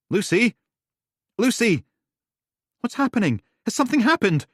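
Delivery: high, loud panicked shouts; alarmed calling. Acted. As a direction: call out in fear. Duncan alarmed.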